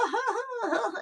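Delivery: high imitated bear chuckle; weird toy laugh. Host imitates how the toy bear would laugh, weird high laugh. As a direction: mimic a talking toy's weird, high laugh. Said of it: "it would laugh weird".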